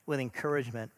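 ordinary delivery

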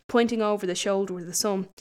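usual speaking voice

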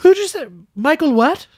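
high, squeaky playing dumb; mock Trump confusion. Host mocking Trump pretending not to know Flynn, squeaky. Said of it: "He's like".

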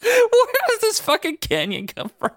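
asking through high, slow laughter; barely getting the question out for laughing. Real, not acted. Laughing while asking about canyon.

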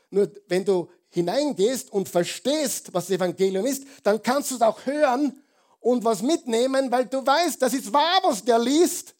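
loud, high preacher's climb; rising into a fervent, loud sermon peak; full-force preaching. Acted. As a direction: drive it home to the congregation.

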